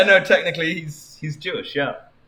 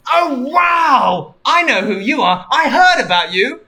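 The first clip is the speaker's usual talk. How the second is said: lightly voiced eager Sonic, raised pitch and volume; a touch of starstruck cartoon hero, pitched up; excited Sonic; 8 semitones higher, 5 dB louder than usual. Sonic meets Anne Frank and knows of her, lightly voiced excitement.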